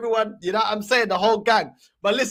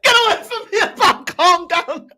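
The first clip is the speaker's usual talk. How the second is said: laughing mimicry; 6 semitones higher, 6 dB louder than usual. Repeats the clip, laughing.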